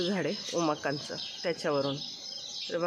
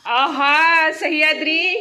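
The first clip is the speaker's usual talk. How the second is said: loud, high delighted greeting; delighted calling out; 6 semitones higher, 11 dB louder, much slower than usual. Real, not acted. Aunt greeting a child at home, delighted calling. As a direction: greet a little one with a big loud cheer.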